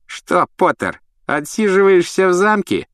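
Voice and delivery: high sneering schoolboy taunt; taunting Malfoy. A narrator's reading. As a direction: jeer at him across the hall. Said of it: "крикнул Малфой".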